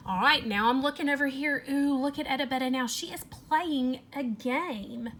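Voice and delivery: ordinary delivery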